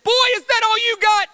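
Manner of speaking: furious high bellow, imitating an opponent; mad challenger's shout. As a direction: roar it as a scornful taunt. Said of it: "and now he was mad".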